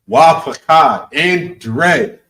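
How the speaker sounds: "Wa" hit hard and high, slowly drawn out; stress on wa. Shown as a demonstration. Teacher stressing "Wa" for the class. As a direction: lean on "Wa" at the start. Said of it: "You see that wa at the beginning".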